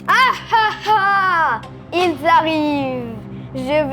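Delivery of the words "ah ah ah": evil laugh. Acted. Villain laughs as robots arrive.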